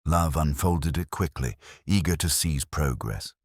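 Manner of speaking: ordinary delivery, nothing marked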